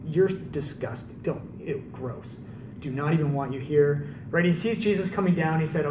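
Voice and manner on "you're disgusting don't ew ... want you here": lightly voiced scornful sneer; a hint of a disgusted crowd. Crowd sneering at the tax collector, mock disgust. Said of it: "they were probably like".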